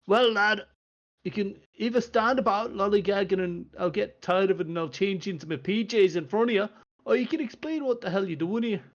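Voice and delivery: a half-dressed dwarf lord losing patience; impatient dwarf. Acted. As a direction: Doran scolding the visitor. Dwarf scolds visitor.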